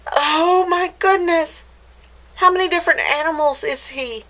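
high-pitched amazement; astonished. Acted. Reader wondering how many animals the dog is, astonished. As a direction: marvel aloud, rushing the question.

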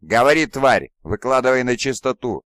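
loud furious demand. A narrator's reading. Primarch demands answers from the choking commander, furious. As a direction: roar the demand at the creature. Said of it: "снова впадая в ярость".

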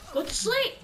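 high loud sleep order; high commanding. Pretend. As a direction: sing it out high. Putting enemy to sleep.

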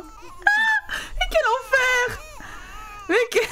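high, slow laughing cry of despair. Real, not acted. Player overwhelmed by crying babies, laughing high yell. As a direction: crack up while yelling at the chaos.

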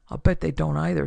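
ordinary delivery

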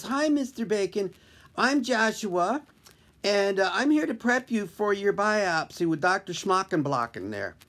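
put-on young intern voice. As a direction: play the boyish intern, lightly.

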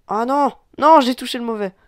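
loud high no; dismayed cry. Real, not acted. Crying out at a misclick. Touched the wrong target.

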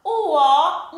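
ordinary talk

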